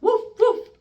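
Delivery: high, loud playful woofs; a grandma barking like a puppy for her grandchild; doggy bark. Acted. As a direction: bark like a little puppy for a baby. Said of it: "just like a doggy".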